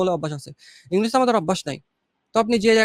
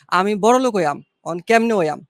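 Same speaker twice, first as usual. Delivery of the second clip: louder village Kishoreganj accent; 5 dB louder than usual. Shown as a demonstration. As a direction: speak it like a Kishoreganj villager, loud and rustic. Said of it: "কিশোরগঞ্জের অ্যাকসেন্টে যদি বলি". (কিশোরগঞ্জের আঞ্চলিক টানে, জোরে)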